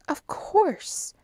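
Hastings, impatient and sure; indignant of course. A narrator's reading. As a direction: scoff at the obvious.

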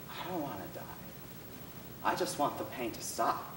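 almost under the breath, afraid; scared, quiet plea. Retold or imitated. Amy on the phone, pleading quietly and afraid. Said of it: "but scared voice".

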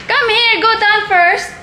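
calling out. Real, not acted. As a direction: yell orders down the stairs. Calling kids during brownout.

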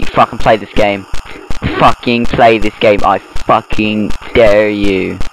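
slow, word-by-word challenge; emphatic dare. Real, not acted. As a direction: spell out the dare slowly, leaning on each word. Challenging viewers who mock him.

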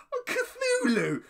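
high, loud drawn-out squeal; delighted squeal. Real, not acted. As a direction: squeal the name out slowly in delight. Acting out delighted reaction to video.

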